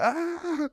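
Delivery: high mock battle scream; retold scream. Screaming while kicking the pads, mock battle cry. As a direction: let out a wild kicking scream. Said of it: "I'm just like screaming like".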